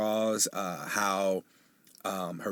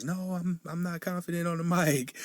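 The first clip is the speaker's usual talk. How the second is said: much higher, a lightly played modest partner; imitating partner's protest; 9 semitones higher than usual. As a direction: toss off her bashful denial in a higher pitch.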